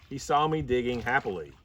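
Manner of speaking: ordinary delivery, nothing marked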